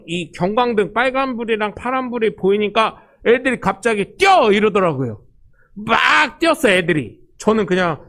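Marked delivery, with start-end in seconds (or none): 4.2-4.5 a sudden high, loud yell to run; retold shout. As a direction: shout the alarm to your friends. Friends flee the police car. (경찰차를 보고 친구들이 외치는 높고 큰 소리)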